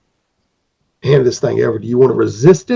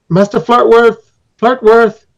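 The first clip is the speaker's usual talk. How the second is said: higher, louder call through the door; 9 semitones higher, 5 dB louder, slower than usual. Acted. Guide calling up to the master: higher, louder call. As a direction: call the master's name up the stairs.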